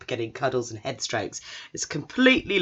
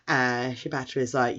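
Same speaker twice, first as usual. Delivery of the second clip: ordinary talk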